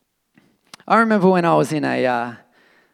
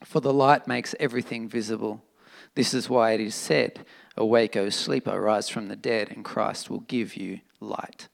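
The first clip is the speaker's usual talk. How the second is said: scripture reading; 7 semitones lower, 7 dB quieter than usual. Acted.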